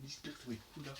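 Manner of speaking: hushed, half-whispered hopes; speaking quietly. Real, not acted. Viewer hoping the episode is good, in a hushed half-whisper. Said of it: "c'est normal si je parle moins fort".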